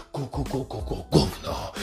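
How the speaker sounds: mock secret whisper. Shown as a demonstration. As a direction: murmur it almost inaudibly. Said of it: "szepcz do ludzi jak najniższym głosem".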